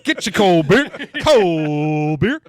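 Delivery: loud ballpark beer man; stadium vendor hawking. Retold or imitated. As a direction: call out cold beer to the crowd. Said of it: "Like she's working at Wrigley Field".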